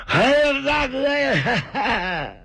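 high, drawn-out wail, sobbing for his big brother; wailing cry. Acted. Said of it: "声泪俱下，嚎啕大哭". (声泪俱下地嚎啕大哭)

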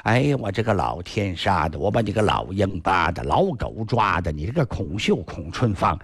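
mock-curse the missing husband through tears; cursing, weeping wife. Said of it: "孟香女儿天天哭啊". (低声哭骂的媳妇)

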